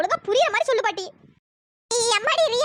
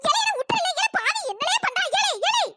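put-on high, wailing old granny shrieking Ele; a startled grandmother crying out as her hand is pulled; 5 semitones higher than usual. Grandmother shrieking at grandson who grabs her hand.

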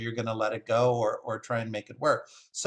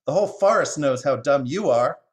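loud, scolding crow; crying out; 3 semitones higher, 7 dB louder than usual. Acted. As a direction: scold the jay loudly from above. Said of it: "cried the crow from above".

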